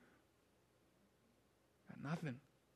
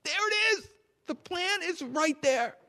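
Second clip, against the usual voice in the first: high plea; 11 semitones higher, 6 dB quieter than usual. Acted. Pleading over the sealed scroll.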